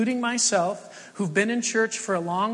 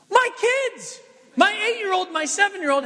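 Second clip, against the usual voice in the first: much higher, louder burst of excitement; excited; 12 semitones higher, 4 dB louder than usual. Real, not acted. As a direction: exclaim it like a delighted dad. Excited reveal of kids' excitement.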